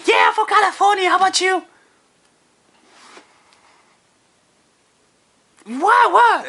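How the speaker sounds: put-on eager girl. Woman answering the guy's question, high and eager.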